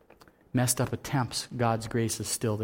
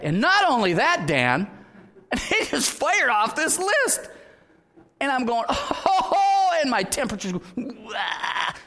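laughing indignation; 11 semitones higher, 4 dB louder, slower than usual. Retold or imitated. Recounting getting angry, laughing.

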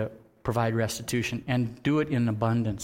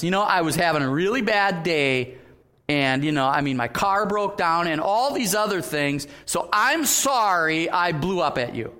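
louder and higher, a self-justifying plea; excuse-making apology; 4 semitones higher, 4 dB louder than usual. Shown as a demonstration. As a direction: talk your way out of blame, a bit pleading. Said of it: "You're just justifying your sin".

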